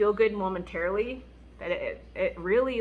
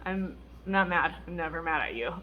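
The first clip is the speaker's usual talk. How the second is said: calm husband; 4 semitones lower, 5 dB quieter than usual. Retold or imitated. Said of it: "he's like".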